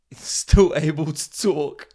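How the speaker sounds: a drawn-out defence breaking with laughter; laughing mock defence. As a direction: vouch for him while cracking up.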